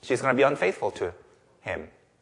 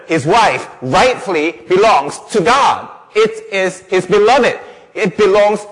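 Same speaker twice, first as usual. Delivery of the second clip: loud, high pulpit declaration; fervent, possessive emphasis, preached hard; impassioned preaching; 6 semitones higher, 6 dB louder than usual. Real, not acted. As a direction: hammer each phrase to the congregation. Preaches at full force.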